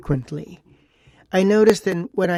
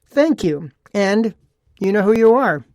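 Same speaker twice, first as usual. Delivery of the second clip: usual speaking voice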